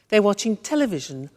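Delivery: normal talking voice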